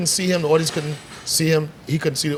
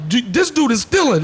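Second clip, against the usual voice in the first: put-on louder, higher kid-voice accusation; mock outrage; 7 semitones higher, 4 dB louder than usual. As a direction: tattle to mom in a raised voice. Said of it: "looked back at his mom like".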